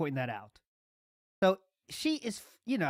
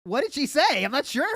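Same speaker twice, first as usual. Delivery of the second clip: higher mock question breaking into laughter; mocking, laughing; 6 semitones higher, 3 dB louder than usual. Host parodying "that's how she said it", laughing mockery. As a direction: parody the contradiction, cracking up at the end. Mocks the streamer's contradiction, laughing.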